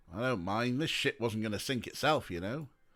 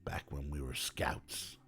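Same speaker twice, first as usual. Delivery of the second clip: rough, much lower and quieter detective voice; Detective McQueen voice; 9 semitones lower, 7 dB quieter than usual. Acted. Detective thanking the kid for the invite, in a rough, much lower voice. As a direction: drop low and rough like a weary cop.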